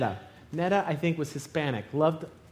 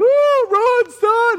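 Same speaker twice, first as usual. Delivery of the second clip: high, very loud frantic holler; mock urgent shout; dad yelling; 9 dB louder, much slower than usual. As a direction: imitate a panicked dad shouting from afar. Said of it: "on the table going".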